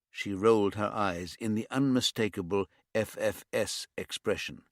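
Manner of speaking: plain reading voice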